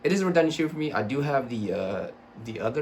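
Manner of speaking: normal talking voice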